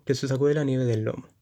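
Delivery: plain reading voice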